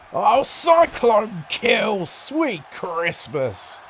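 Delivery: slow, loud stretched-out call; excited. Acted. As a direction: sell the finisher with big excitement. Finisher hits, commentator erupts.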